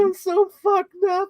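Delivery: high, slow wailing apology; hammed-up sobbing confession; mock breakdown. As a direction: imitate tearful remorse, way up in pitch. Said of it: "both characters break down and say that they're sorry".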